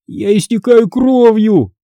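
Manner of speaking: high, drawn-out pained whine; high, stretched whimper. A narrator's reading. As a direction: whine in hurt panic. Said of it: "заскулил Карло".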